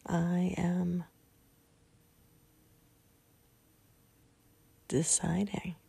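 calm, spaced-out phrases with long pauses; guided meditation. Real, not acted. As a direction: lead them gently into relaxation, a few words at a time. Leads the sleep meditation.

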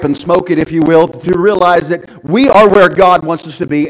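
loud, high exhortation to the church; full-force preaching. Acted. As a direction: drive the verse home to the congregation.

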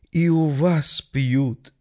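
very slow astonished ask; drawn out in amazement; surprised question. Acted. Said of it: "удивился голос". (удивлённо)

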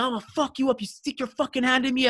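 high-pitched imitation of a raging opponent; mock angry threat. Sore loser threatening the hand-shaker, mock furious.

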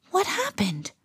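high, soft gasp of surprise; softly astonished; surprised. A narrator's reading. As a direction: ask it in hushed, high-pitched shock.

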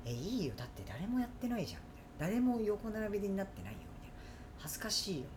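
soft, mildly embarrassed brush-off; quietly decline, as if bashful; reluctant refusal. Retold or imitated. Husband shyly refusing to sit beside his wife, soft reluctance.

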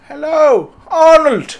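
high voice for a pig; squeaky talking pig voice. Retold or imitated. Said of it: "my pig he spoke to me".

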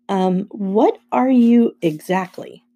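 slow, hesitant questioning; wary bafflement. A narrator's reading. Boy asks friend what he is.

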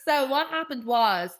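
normal talking voice

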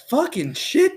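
high, sharp cry of pain, retold; retold curse at a hornet sting; pained cursing. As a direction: blurt it out as the sting burns.

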